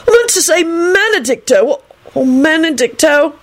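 high, agitated correction; angry holler. A narrator's reading. Alex fixing his botched spell, angry. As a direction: correct yourself crossly in a high voice. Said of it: "Alex hollered angrily".

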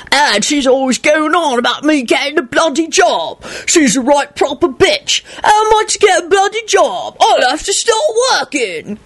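high whiny Cockney impression. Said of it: "a whiny Cockney voice that was unmistakably Victor's".

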